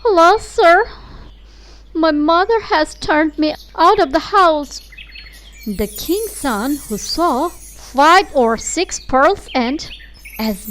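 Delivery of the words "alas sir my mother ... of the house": higher, louder plaintive cry; cast-out girl telling her woe; lamenting girl. Acted. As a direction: plead your sorrow to the prince. Girl tells prince her misfortune.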